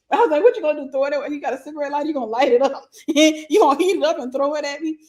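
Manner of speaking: high, loud retelling through laughs; laughing mockery. Real, not acted. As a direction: tease incredulously while cracking up. Cracking up retelling her mocking reply.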